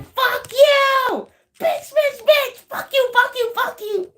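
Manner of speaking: very high mock tantrum; hammed-up high-pitched rage; mad person cursing. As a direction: squeal curses in a rapid string. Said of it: "This person is so mad".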